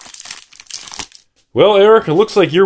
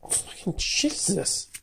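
slow, hushed whisper of disbelief; stunned whisper; 3 semitones higher, 15 dB quieter, slower than usual. Real, not acted. Opener stunned at last pack, slow awed whisper. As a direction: whisper it, much softer than usual.

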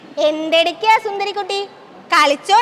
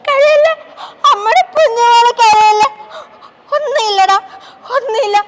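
sobbing and louder, pleading; crying comfort; 4 semitones higher, 6 dB louder than usual. Acted. Mother begs crying daughter not to cry, sobbing. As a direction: comfort her through your own tears.

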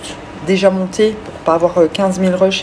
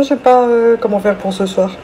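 usual speaking voice

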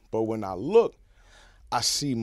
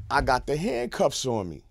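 usual speaking voice

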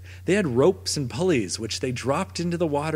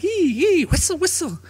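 high squeaky otter chirps; otter chatter; 6 dB louder than usual. Acted. Otter answering the dog, high squeaky chirps. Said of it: "The otter said".

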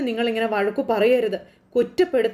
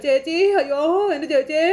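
higher, theatrical lament for sister; mock wail; 5 semitones higher than usual. Uncle rushing in, wailing theatrically for sister. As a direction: cry out as if heartbroken, staged. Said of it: "ഓടിക്കതച്ച് അകത്തേക്ക് കയറി".

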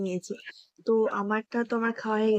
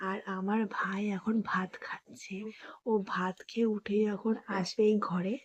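hushed whisper so brother won't hear; 8 dB quieter than usual. Real, not acted. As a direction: whisper it secretly. Hiding plan from brother.

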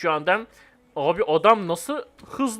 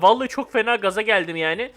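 ordinary delivery

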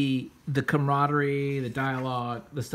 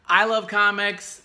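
normal talking voice

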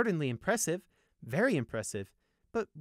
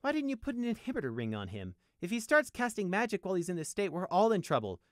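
high-pitched urgent warning; agitated, raised-voice scolding; alarmed protest; 10 semitones higher, 4 dB louder than usual. A narrator's reading. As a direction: warn them, growing louder.

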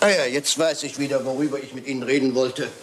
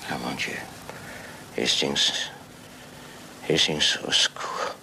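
faint, fading gasp; 14 semitones lower, 6 dB quieter, slower than usual. Acted. Poisoned Poirot collapses, calls Hastings.

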